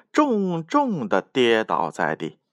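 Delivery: plain reading voice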